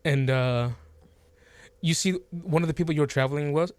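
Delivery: normal talking voice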